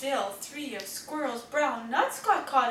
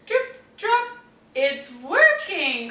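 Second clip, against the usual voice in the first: higher, louder delight as the drops fall; excited cry; 3 semitones higher, 7 dB louder, slower than usual. Acted. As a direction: cry out that it's working, thrilled. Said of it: "cries Olivia".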